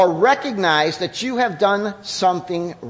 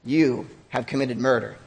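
gentle Jesus; 8 semitones lower than usual. Acted. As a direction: lay it down gently but firmly. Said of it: "he says".

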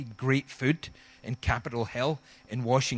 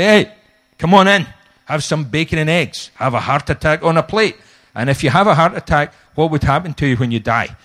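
booming friendly shout, louder; loud Scottish welcome; 11 dB louder than usual. Retold or imitated. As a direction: call them in heartily. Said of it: "So we say".

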